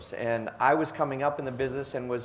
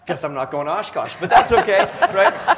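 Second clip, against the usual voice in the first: loud laugh bursting through, much louder and higher; 4 semitones higher, 9 dB louder than usual. Real, not acted. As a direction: shrug off the letdown with a big laugh. Laughing off the rejection.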